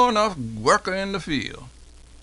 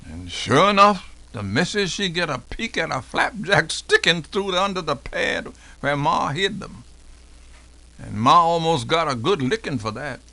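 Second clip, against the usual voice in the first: plain reading voice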